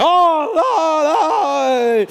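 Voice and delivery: put-on loud, shocked old priest; horrified priest. Deaf priest reacts to the confession, loud shocked horror.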